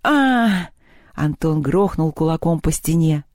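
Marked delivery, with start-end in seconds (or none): 0.0-0.7 high, frustrated "aah"; acted groan of despair; anguished cry. A narrator's reading. Антон в отчаянии бьёт стену.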